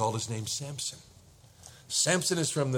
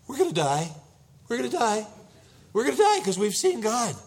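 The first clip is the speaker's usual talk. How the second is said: higher, louder panicked husband; 10 semitones higher, 5 dB louder than usual. Retold or imitated. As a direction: cry out in acted panic to your wife. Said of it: "Shaking violently, I'm sure".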